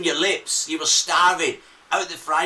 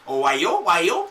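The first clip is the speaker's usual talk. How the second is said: hammed-up, rising Newcastle lilt; Newcastle accent; 4 dB louder than usual. Host imitating a Newcastle woman, sing-song Newcastle accent. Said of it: "the Newcastle accent".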